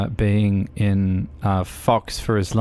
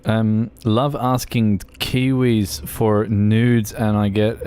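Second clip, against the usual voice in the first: ordinary talk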